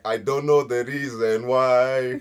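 slow drawn-out punchline. Retold or imitated. Telling friends the Zimbabwean meme, slow and drawn out. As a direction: land the joke slowly. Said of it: "But Zimbabwean people be like".

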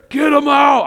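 loud, high angry shout; imitated furious outburst; mad uncle. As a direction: yell it like you want it gone now. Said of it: "I mean, he was mad".

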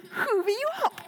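high-pitched comic wail; mock alarm. Child to dad about being swallowed, mock-alarmed. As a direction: stretch it high like a hammed-up panic.

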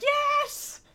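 very high excited squeal; excited yes. Retold or imitated. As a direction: cheer like a thrilled fan. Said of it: "You're like".

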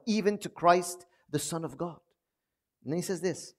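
ordinary talk, nothing marked

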